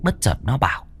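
usual speaking voice

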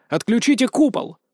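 high, urgent battle command; shouted order. A narrator's reading. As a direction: call it out to the men. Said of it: "прокричал я".

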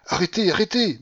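high, loud plea to stop; frantic cry. A narrator's reading. General begging companion to halt her, frantic. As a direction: cry out, beside yourself. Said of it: "me criait le général hors de lui".

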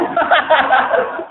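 high laugh trailing off. Real, not acted. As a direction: laugh it away. Laughs at his own joke.

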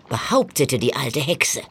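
plain reading voice

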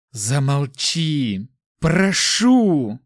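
drawn-out cry rising in pitch; desperate, stretched-out pleading; anguished plea. A narrator's reading. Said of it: "Хрип вырвался сам собой, едва слышимый".

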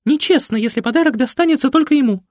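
high, loud, fast complaint; petulant diva. A narrator's reading. Mother complaining the gift went only to her son. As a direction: pout and whine.

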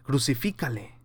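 ordinary talk, nothing marked

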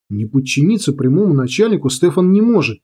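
plain reading voice

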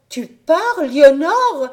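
high, loud cry of alarm. A narrator's reading. Little sister crying out to Éléonore in alarm. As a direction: cry out as if she is leaving. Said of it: "cria la petite".

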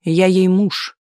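mildly proud announcement; a touch of pride. A narrator's reading. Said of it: "гордо ответил Анатолий".

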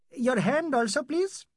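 a hint of a brisk physician; doctor's voice. Doctor asks the patient for his hand, lightly voiced.

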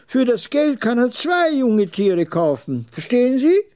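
put-on high, emphatic old man's sales talk; high-pitched, insistent trader; old camel trader, superior. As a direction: lecture the visitor in a high old voice.

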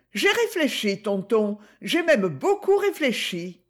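lightly voiced young Gigi, protesting; Gigi, young girl. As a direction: play the girl, defiant and earnest. Gigi speaks to Gaston.

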